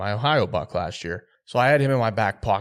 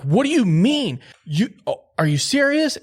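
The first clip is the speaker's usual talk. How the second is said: much higher, louder, half-shouted disbelief; ecstatic, high-pitched are you serious; freaking out; 12 semitones higher, 7 dB louder than usual. Retold or imitated. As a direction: lose it with excitement. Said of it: "I was, like, freaking out".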